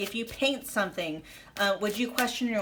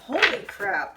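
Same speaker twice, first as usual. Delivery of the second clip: ordinary talk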